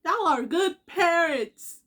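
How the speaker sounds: loud, slow y'all are good parents; heartfelt praise. Real, not acted. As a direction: press each word to the parents.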